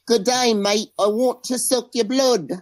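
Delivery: high-pitched Australian vampire greeting; Australian accent. Acted. Vampire guest greeting the hosts, hammed-up Australian accent. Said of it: "That's [name]'s Australian accent".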